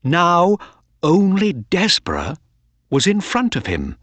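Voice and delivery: usual speaking voice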